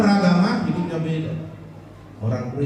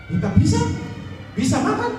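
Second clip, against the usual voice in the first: put-on Japanese-accented Indonesian, much higher and sing-song; 12 semitones higher than usual. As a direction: put on a comic Japanese accent, pitched up high. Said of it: "Nah, ini pasti orang Jepang ini". (logat Jepang yang lucu, nada jauh lebih tinggi)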